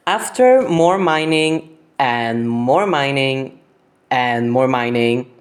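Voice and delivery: slow, repetitive drag; drawn-out tedium. Real, not acted. Narrator recaps endless mining to viewers, drawn-out tedium. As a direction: stretch out each round of mining. Lists tedious repeated mining.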